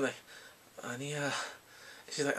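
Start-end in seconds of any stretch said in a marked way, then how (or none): 0.8-1.3 soft, understated denial; acted denial. Answering the father's question about dating, quiet denial. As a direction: brush it off quietly.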